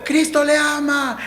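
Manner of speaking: soft loving words; gentle. Shown as a demonstration. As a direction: say it softly, like a caress. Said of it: "es diferente que yo le diga".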